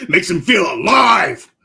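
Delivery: loud, slow mock-triumphant yell; hammed-up shout. Co-host joking about his friend's spicy snack, hammed-up shout. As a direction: belt it out, drawing out the words.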